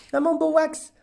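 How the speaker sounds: doting owner cooing at her cat; cooing pet talk. Real, not acted.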